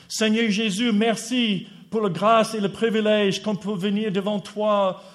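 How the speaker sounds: prière à voix haute et plus aiguë, élan fervent; prière fervente. Retold or imitated. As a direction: implorer le Seigneur avec ferveur. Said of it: "Nous avons prié ce matin". (il rejoue la prière du matin, fervente et plus forte)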